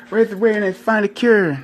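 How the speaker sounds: high, loud chant through laughter; laughing mock slogan. Joking about herpes awareness, loud laughing chant. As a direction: giggle out a campaign slogan.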